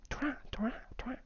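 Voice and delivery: very fast whispered 土豆沙拉. Shown as a demonstration. Teacher whispers 土豆沙拉 as a fast drill. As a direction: breathe 土豆沙拉 out quick and soft. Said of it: "really fast like that".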